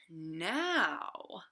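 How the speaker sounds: usual speaking voice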